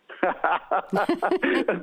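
delighted laughter; joyful laugh. Real, not acted. Preacher moved by his own testimony, laughing joyfully. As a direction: laugh out in wonder.

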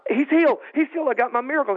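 high-pitched excited outburst; thrilled, breaking the news; excited retelling. Retold or imitated. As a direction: burst out with amazing news, voice jumping up. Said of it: "I'm all excited".

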